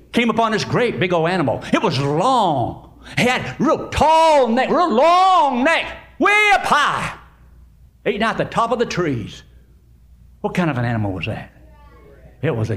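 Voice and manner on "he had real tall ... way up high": high, slow, stretched words climbing upward; big, drawn-out storyteller wonder; awed height description. Acted. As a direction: stretch your voice up like the neck. Describes giraffe height to children.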